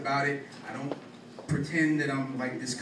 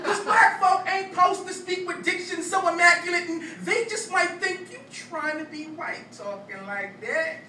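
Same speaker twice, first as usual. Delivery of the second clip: higher, louder, rhythmic slam-poem delivery; spoken-word poetry; 13 semitones higher, 5 dB louder than usual. Acted. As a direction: perform it as a punchy stage poem.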